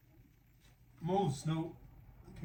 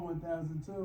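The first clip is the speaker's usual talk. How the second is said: ordinary talk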